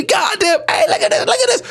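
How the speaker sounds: high, excited mocking kid; jeering schoolkid. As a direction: call the others over to gawk. Acted kids jeering at him.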